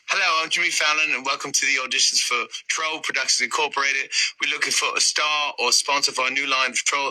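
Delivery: host an audition in a mock British accent; amateur British announcer voice. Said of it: "a British accent".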